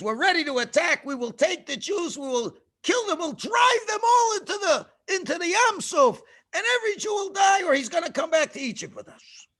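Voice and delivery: high, loud, shouted threat; Pharaoh's war threat. Acted. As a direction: roar the threat at the slaves.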